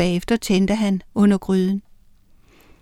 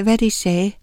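plain reading voice

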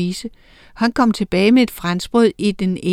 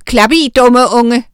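loud, snappish shout; 3 semitones higher, 8 dB louder than usual. A narrator's reading. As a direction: snap at the little one. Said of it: "råbte Ado".

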